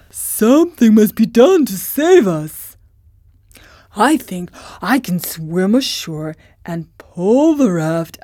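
rally them as the lion; brave lion voice. Acted. Lion plans rescue.

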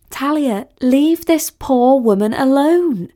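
high, drawn-out plea; pleading. A narrator's reading. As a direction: plead with an unreasonable friend. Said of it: "pleaded Sally".

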